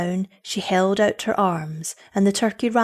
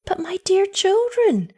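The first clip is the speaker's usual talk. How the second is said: high-pitched astonished mother; motherly surprise, pitched well up; astonishment; 13 semitones higher than usual. A narrator's reading. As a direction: protest in amazement. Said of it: "said the mother in astonishment".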